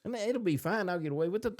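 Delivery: ordinary delivery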